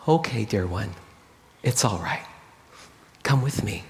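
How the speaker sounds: low, soft, gentle reassurance; calm her down gently, unhurried; soothing. A narrator's reading. New shepherd to a shaking, scared lamb, soothing.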